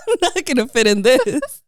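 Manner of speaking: laughing through the words; giggling while she says it. Real, not acted. Repeating the line while laughing.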